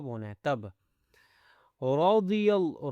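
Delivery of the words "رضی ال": رضی with thick ra and daad; slow tajweed reading of رضی; heavy ra and daad. Shown as a demonstration. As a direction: chant رضی slowly with a full mouth. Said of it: "را اور ضاد کو موٹا کرنا ہے".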